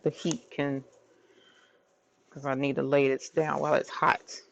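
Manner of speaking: ordinary talk, nothing marked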